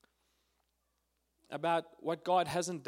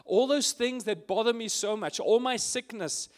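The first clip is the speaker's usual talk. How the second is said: prayer; 2 semitones higher, 4 dB louder than usual. Acted. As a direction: start hushed, then pour out the fears louder. Voices his prayer to Abba.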